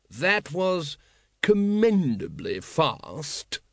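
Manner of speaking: very slow, drawn-out drollery; unruffled ruler. Acted. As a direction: stretch the praise out languidly. Patrician taunts the runaway calmly.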